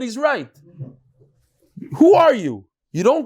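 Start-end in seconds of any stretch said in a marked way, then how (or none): 2.0-3.2 louder, higher "Who are you?"; indignant challenge to a presumptuous son; indignant rebuke. Acted. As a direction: slap down the upstart.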